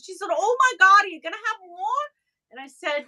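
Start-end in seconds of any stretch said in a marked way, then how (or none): 0.3-2.1 put-on New York accent. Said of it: "weird New York".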